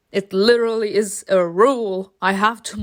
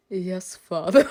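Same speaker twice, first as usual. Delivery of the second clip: bored obedience; 3 semitones lower than usual. Acted. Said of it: "literally is bored to death".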